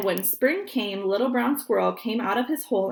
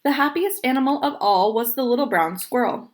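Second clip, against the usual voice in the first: ordinary delivery, nothing marked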